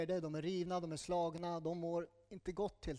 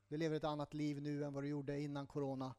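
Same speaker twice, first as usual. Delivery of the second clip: ordinary delivery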